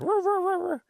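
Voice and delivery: mock dog howling in a high pitch; imitated dog howl. Storyteller imitates the old man's dog in the yard, howling. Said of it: "like a dog howling".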